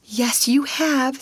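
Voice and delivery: slow, high, cheery lilt; upbeat comforting tone; cheerful reassurance. A narrator's reading. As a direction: reassure a crying child brightly. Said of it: "said Sarah cheerfully".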